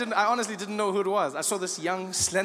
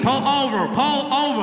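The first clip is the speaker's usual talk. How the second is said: higher, emphatic cop command; imitated loudspeaker command; police loudspeaker order; 6 semitones higher, 4 dB louder than usual. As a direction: bark it like police over a speaker. Cop barks order over speaker.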